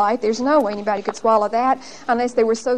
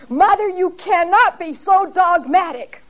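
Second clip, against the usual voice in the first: mock yell, higher and slow; retold scream; 7 semitones higher, 4 dB louder than usual. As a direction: imitate the daughter yelling at her mother, higher. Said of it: "she just screamed it out".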